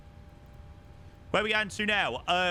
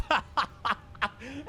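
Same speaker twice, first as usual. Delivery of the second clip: high burst of laughter; real laugh; 9 semitones higher than usual. Real, not acted. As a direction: crack up at the silly lyric. Cracks up at misheard lyric.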